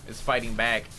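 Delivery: ordinary talk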